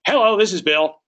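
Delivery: loud, high, irritated hello; angry phone pickup. Acted. Owner of an expired listing answering, angry and loud. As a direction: snap the greeting into the phone. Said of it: "people who are angry".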